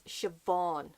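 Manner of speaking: Siobhan with a V, shuh-VAWN; slow Siobhan, the middle sound a clear V; correct Siobhan. Shown as a demonstration. Correcting viewers on an author's name, Siobhan with V. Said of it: "It's a V".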